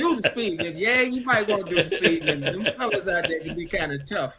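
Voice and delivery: repeating it through laughter; laughing. Real, not acted. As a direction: laugh along as you agree. Echoes joke.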